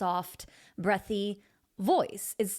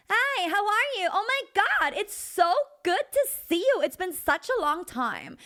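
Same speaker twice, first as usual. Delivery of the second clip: put-on high, gushing Valley Girl; 10 semitones higher, 4 dB louder than usual. Shown as a demonstration. Coach exaggerating a greeting for the student, Valley Girl voice. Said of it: "do the Valley Girl voice".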